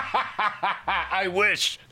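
high laugh breaking into the words; wistful reply through a big laugh. Acted. Aldalar answers the leash joke, laughing.

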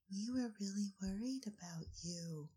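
whispered worried line; hushed whisper of worry. Acted. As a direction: breathe it out softly in concern. Whispered concern in character.

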